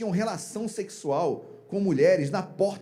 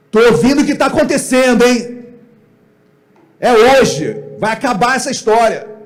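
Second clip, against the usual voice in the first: loud, raised shout of parental fury; stern angry father; 5 semitones higher, 10 dB louder than usual. Acted. Father confronting his wayward sons, angry shouted ultimatum. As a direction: lay down the law at full volume. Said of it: "Como é que você falaria?".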